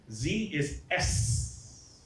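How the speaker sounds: very slow "Z is S", the Z hissed like an S; slow demo of Z sounding as S. Shown as a demonstration. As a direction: draw out "Z is S" slowly. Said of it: "Spanish spoken in the Philippines".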